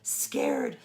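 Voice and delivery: frightened whisper, slow; scared whisper. Acted. Grover whispering to the kids, scared. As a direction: whisper it like a trembling furry monster. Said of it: "I am so scared".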